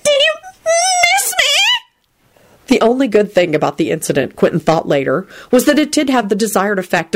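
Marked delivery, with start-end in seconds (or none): 0.1-2.7 very high ecstatic shriek. A narrator's reading. Loretta greeting Quentin, very high ecstatic shriek. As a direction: squeal it with wild joy. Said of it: "she shrieked ecstatically".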